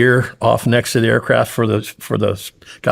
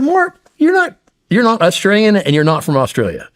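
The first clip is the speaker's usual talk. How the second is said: incredulous outburst; 10 semitones higher than usual. Retold or imitated. Incredulous outburst at a teammate faking an Australian identity. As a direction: call out the obvious lie. Disbelief at fake Australian claim.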